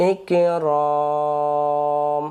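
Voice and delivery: ikrom with the long vowel stretched to three alif; drawn-out long vowel. Shown as a demonstration. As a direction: stretch the ra out long, Quran style. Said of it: "আর যদি বলি ইকরম".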